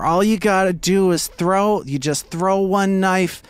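put-on high, loud drunken sales pitch; drunken hustler. Drunk hustler talking a woman into knife throwing, loud and pushy. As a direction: loudly talk it up like a cocky drunk. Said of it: "and he's drunk. He's like".

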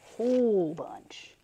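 very slow, loud, stretched WHOLE; emphatic WHOLE. Real, not acted. Repeating to viewers how much there is, WHOLE heavily stretched. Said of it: "I'm not kidding".

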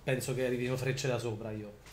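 ordinary talk, nothing marked

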